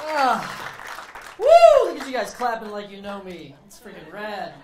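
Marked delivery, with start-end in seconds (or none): 0.1-1.9 loud, high woo; loud high whoop on stage. Real, not acted. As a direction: hype the crowd with a whoop. Comic cheers taking stage.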